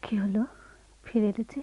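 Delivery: soft, hushed and surprised; hushed question. Acted. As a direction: ask him quietly why he's back. Wife whispers to returning husband. (স্বামীকে ফিসফিস করে জিজ্ঞেস করা)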